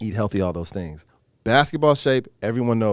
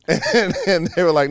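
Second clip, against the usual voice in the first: ordinary talk, nothing marked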